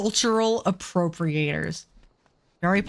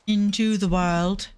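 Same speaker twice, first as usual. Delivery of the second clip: slow, drawn-out grandiose delivery; mock-epic narration; 3 semitones higher, much slower than usual. Player narrating the run into the world, slow and grandiose. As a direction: stretch each phrase with long pauses.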